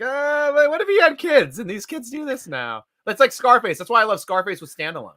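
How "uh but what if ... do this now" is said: mock studio pitch. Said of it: "They're like".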